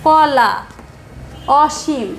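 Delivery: কলা and অসীম said with their natural অ vowel, "kola", "oshim"; স্বাভাবিক অ উচ্চারণ; natural o sound. Shown as a demonstration. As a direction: say কলা and অসীম with the plain open অ. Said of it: "অটা স্বাভাবিকভাবেই উচ্চারণ হচ্ছে".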